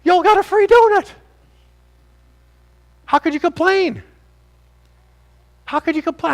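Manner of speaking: high, loud exclamation of disbelief; exclaiming. Real, not acted. Preacher to the congregation, exclaiming loudly in disbelief. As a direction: shout it out in amazement.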